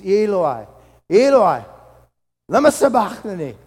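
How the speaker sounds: anguished loud cry to heaven. Acted. Jesus crying out from the cross, loud anguished cry. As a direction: call out to God in agony at full voice. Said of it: "cried out, in a loud voice".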